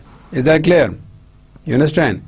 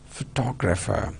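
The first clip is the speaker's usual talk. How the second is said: "photographer" said softer, stressed on TOG; pho-TOG-rapher, quietly demonstrated; second-syllable stress; 9 dB quieter than usual. Shown as a demonstration. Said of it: "Stress syllables, two".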